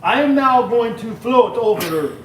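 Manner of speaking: loud, slow mock Count Chocula voice; mock vampire voice. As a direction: glide like a vampire in the kitchen. Slipping into vampire voice.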